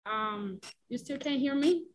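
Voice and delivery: ordinary talk, nothing marked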